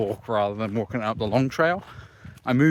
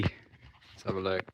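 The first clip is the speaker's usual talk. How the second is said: usual speaking voice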